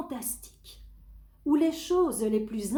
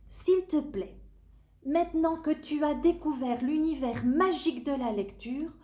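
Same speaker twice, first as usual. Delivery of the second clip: pleading; 6 semitones higher than usual. Acted. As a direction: beg the monster gently. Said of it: "supplie Tony".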